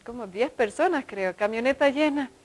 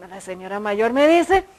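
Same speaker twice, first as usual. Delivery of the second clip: normal talking voice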